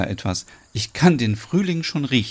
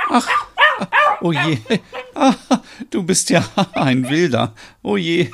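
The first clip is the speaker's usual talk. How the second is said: laughing at dog; 7 semitones higher than usual. Acted. Big dog jumps at her.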